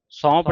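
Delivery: ਸੌਂਪ loud, with a nasal vowel; nasalised misreading. Shown as a demonstration. Teacher warns against it, ਸੌਂਪ with nasalised vowel. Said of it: "ਸੌਂਪ ਨਹੀਂ ਪੜ੍ਹਨਾ".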